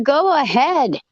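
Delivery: high, goading retold challenge; defiant dare. Daring her to call the cops, defiant. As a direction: egg them on, pitched well up.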